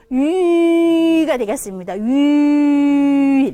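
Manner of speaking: 위 drawn out long and loud as one ü sound; held vowel. Shown as a demonstration. Teacher models the finished vowel, long held 위. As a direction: sustain 위 without letting it slide to i. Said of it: "위에서 멈춰버려요".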